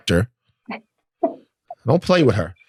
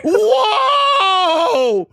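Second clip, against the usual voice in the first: high shriek mid-laugh; laughing shout; much slower than usual. Real, not acted. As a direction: cry out through laughter.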